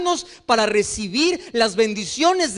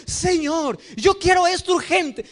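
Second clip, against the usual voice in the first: mock impatient plea, raised; súplica impaciente; 6 semitones higher than usual. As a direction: demand it like a spoiled child. Congregación imitada pidiendo urgente. (súplica impaciente e insistente)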